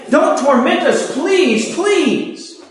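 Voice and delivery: high-pitched imitation of begging; frantic pleading. Demons pleading with Jesus, acted.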